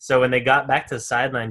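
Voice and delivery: ordinary delivery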